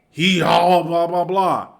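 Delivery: loud, silly mocking babble; mock ranting. Shown as a demonstration. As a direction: ham up a senseless rant. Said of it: "but I'm not".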